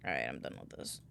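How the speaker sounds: ordinary talk, nothing marked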